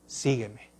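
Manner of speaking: a quiet, intimate invitation to follow; Jesus calling softly. Acted. Jesus' call voiced in a sermon. (Jesús llamando en voz baja y suave)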